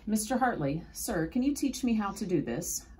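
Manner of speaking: put-on high, tentative boy's request; a touch of bashfulness; shy asking. A narrator's reading. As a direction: ask the mentor shyly but eagerly. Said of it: "I asked shyly".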